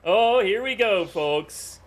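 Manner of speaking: high-pitched, excited live commentary; live esports caller, pitched well up; excited play-by-play. Real, not acted. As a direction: call the tightening finish with rising excitement. Live play-by-play, lead changes.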